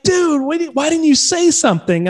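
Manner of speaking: high, incredulous mock reproach; retold exasperation. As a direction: protest in a high, imploring voice. Retold star reproaching modest fan.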